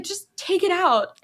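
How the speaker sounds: a hint of Jack pushing the joke; partner insisting. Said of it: "he's like".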